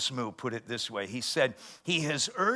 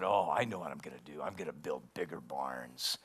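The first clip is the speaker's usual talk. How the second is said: much lower, softer voice of the smug rich man, fast then fading; rich fool; 9 semitones lower, 5 dB quieter than usual. Retold or imitated. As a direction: muse to yourself like a self-satisfied rich farmer. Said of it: "He said".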